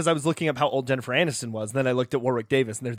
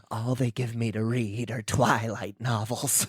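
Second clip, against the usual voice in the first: gasping demon voice; 6 semitones lower, 5 dB quieter than usual. Pretend. Scripto gasps, complains.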